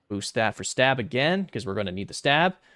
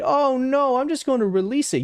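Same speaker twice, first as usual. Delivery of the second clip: mocking quote; 14 semitones higher, 5 dB louder than usual. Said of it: "And you decide".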